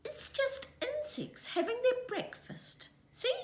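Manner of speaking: very high cheerful mother voice; light, bright motherly reassurance; mother kiwi. A narrator's reading. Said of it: "she said".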